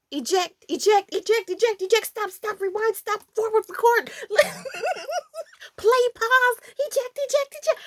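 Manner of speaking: high, rapid frantic commands; frantic button mashing. Retold or imitated. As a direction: jab every button in a panic. Frantic kid pressing buttons.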